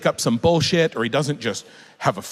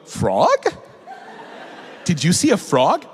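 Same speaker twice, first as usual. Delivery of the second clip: higher, playfully puzzled question; innocently curious parent; 5 semitones higher than usual. Acted. Parent pretending to mishear the child's swear, innocently curious. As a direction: feign innocence, as if to a toddler. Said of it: "I go".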